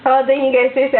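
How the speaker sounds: ordinary talk, nothing marked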